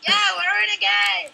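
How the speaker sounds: loud joyful cheering; excited cheer. Real, not acted. Finally back in game.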